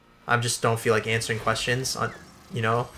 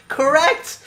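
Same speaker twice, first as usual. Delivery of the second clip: high-pitched, sing-song teacher's "correct"; mock teacher; 14 semitones higher, 3 dB louder than usual. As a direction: mimic the teacher's sudden approval, much higher. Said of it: "and then she goes".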